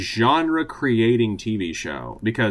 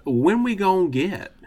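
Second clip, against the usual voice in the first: Southern accent; 9 semitones higher than usual. Shown as a demonstration. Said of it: "this Southern accent segment".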